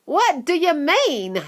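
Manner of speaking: lightly voiced daughter, chewing; chewing girl. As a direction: talk round the gum. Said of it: "said Violet, still chewing away".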